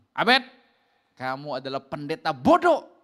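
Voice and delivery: loud, imitated scolding; fierce insult. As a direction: call the name sharply, then insult loudly. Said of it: "oh, galak". (hardikan galak, suara keras)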